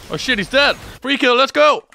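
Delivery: high, loud yell of glee; excited shout. Real, not acted. Streamer cheering a surprise kill, shouting with joy. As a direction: whoop at the free kill. Enemy dies, free kill.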